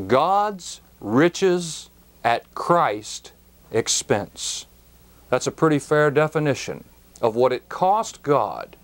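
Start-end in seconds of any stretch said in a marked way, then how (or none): 0.1-4.7 very slow, each word set apart; spelled-out acrostic. Shown as a demonstration. Preacher spelling out GRACE to listeners, very slow.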